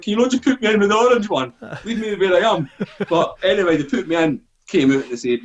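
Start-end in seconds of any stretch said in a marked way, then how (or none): 0.0-2.7 high-pitched retold complaint; pained protest. Injured soldier to those moving him, high retold protest. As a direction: beg to be left alone, pitched up.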